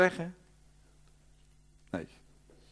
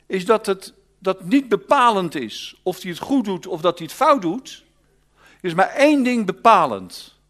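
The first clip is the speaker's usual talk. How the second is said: higher, louder, driving the point home; fervent preaching; 8 semitones higher, 9 dB louder than usual. Acted. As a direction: preach it to the congregation. Preaching at full force.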